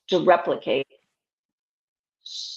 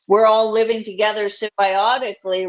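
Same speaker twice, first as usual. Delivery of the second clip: ordinary delivery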